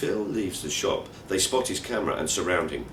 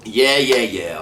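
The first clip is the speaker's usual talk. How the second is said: much higher, louder brush-off that drops away; dismissive yeah yeah; 3 semitones higher, 9 dB louder, slower than usual. Retold or imitated. Narrator brushing off Eunice, impatient and dismissive. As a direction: wave the warning off with impatient yeahs.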